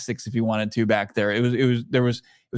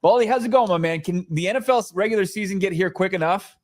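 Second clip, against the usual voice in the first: show segment intro; 5 semitones higher than usual. Acted. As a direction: hype up the guest's entrance like a broadcast. Host opens segment, introduces analyst.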